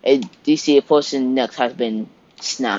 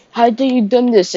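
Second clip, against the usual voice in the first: angry shout; 10 semitones higher, 4 dB louder than usual. Retold or imitated. Husband confronts killer.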